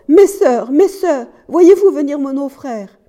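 high, loud, desperate call; crying out. A narrator's reading. Wife calling her sisters as death nears, crying out. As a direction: cry out to someone far off. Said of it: "Elle cria encore".

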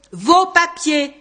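loud, slow official demand; authoritative. A narrator's reading. Policeman demanding papers again from Jérôme, authoritative. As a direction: repeat it slowly with firm authority. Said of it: "répéta l'homme avec autorité".

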